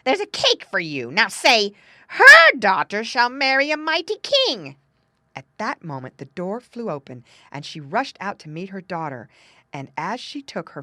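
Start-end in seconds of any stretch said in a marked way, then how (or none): 0.1-4.8 loud, bossy dictation rising high; scolding. A narrator's reading. Woman bribing the doggy with cake, loud scolding. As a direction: force the words on the dog loudly. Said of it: "scolded the woman".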